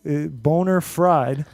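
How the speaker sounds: boner fried said slowly, as a mispronounced Bonafide. Retold or imitated. Reviewer's joke about a cohost's name, said slowly as boner fried. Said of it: "Bonafide always sounds like".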